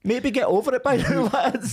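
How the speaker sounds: high-pitched, laughing tease; amused taunt lifted by laughter; laughing jibe. Real, not acted. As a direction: laugh as you rib the lads. Laughing jibe at opposing fans.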